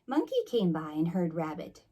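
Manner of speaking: ordinary delivery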